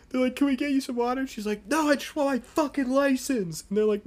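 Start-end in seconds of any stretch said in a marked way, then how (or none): 0.4-1.2 higher-pitched retold paramedic offer; concerned EMTs. Acting EMTs offering help. 1.7-3.6 high-pitched whining mock outburst; hammed-up stubborn protest; irate customer. Acting the irate woman.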